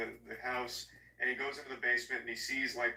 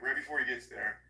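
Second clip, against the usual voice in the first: usual speaking voice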